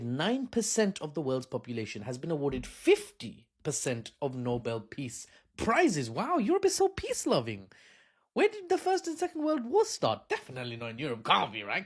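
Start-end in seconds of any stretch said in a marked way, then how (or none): 6.1-11.8 high mock awe; sarcastic. Comedian mocks Europe as peace-loving, high sarcastic gush. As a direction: gush with fake admiration.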